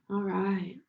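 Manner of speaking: ordinary talk, nothing marked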